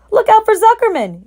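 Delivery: very high loud yell. A narrator's reading. Gander yelling a warning at Wilbur. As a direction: bellow the warning. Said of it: "yelled the gander".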